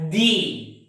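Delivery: "the" said as thee, with long ee; long ee vowel in "the"; strong form of the. Shown as a demonstration. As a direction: draw out "the" to rhyme with see. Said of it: "have to pronounce this word as".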